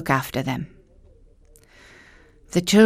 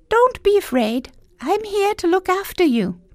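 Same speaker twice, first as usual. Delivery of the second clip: put-on high, gentle boy voice; young boy voice; 14 semitones higher than usual. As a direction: comfort her as a small brave boy. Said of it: "Hansel said".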